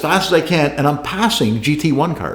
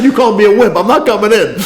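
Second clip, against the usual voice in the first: higher, laughing, mock-stubborn reply; laughing defiance; 8 semitones higher, 5 dB louder, faster than usual. Driver answering the crew chief who called him a wimp, laughing and defiant. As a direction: toss it back with a grin. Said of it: "I said".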